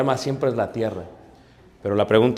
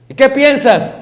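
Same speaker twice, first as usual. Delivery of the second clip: higher, louder probing question; imitating wife; 11 semitones higher, 10 dB louder than usual. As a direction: put him on the spot.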